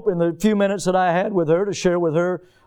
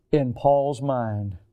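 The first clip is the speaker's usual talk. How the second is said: ordinary delivery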